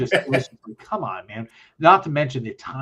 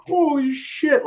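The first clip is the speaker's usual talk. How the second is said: astonished; 3 dB louder, slower than usual. Retold or imitated. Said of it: "my team was like".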